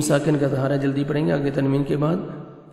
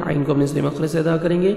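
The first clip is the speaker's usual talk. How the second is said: ordinary delivery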